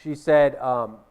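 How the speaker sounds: ordinary delivery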